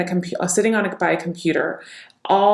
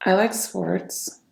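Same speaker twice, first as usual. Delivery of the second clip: sad; 4 dB quieter, much slower than usual. Shown as a demonstration. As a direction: drag it out, subdued and downcast.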